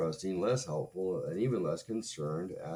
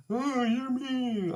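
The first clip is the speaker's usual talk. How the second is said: much higher, slow, hurt little voice; mock her wounded whimper; mock whiny ex; 6 dB louder, much slower than usual. Ex got upset, mocking her in a whiny voice. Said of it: "and she was like".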